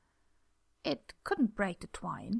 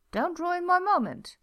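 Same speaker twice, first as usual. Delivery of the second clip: higher, louder, playfully offended; mock indignant; 9 semitones higher, 6 dB louder than usual. Jack protesting the team's groans, mock indignant.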